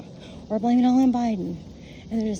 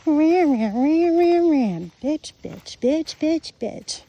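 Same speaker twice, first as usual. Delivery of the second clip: higher, louder nonsense grumble mimicking a complainer; mock ranting; 5 semitones higher, 4 dB louder, much slower than usual. Mimicking negative people who complain all day, high mock rant. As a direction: parody the endless bitching, pitch jumping up.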